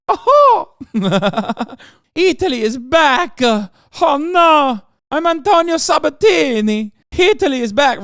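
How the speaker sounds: fake Italian accent. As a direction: play an over-the-top Italian caricature. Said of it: "the fake Italian accent".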